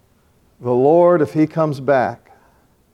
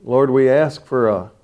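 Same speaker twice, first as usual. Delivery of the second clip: earnest prayer; 3 semitones lower than usual. Acted. Continues the prayer.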